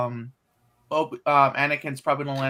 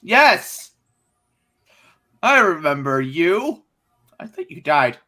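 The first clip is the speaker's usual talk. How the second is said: droid voice; 5 dB louder, much slower than usual. Acted. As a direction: shout out a delighted droid greeting. Droid voice greeting an old friend.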